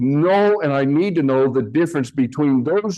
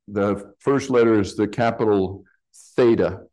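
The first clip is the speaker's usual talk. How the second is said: usual speaking voice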